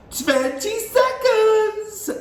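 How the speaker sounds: high, loud, slow countdown call; witch crying out. A narrator's reading. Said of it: "cried the Grand High Witch".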